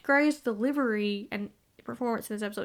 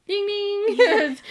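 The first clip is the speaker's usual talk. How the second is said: high bright ding ding; bell chime; 11 semitones higher, 7 dB louder than usual. Pretend. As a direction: sing out like a bell. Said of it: "mock bell sound".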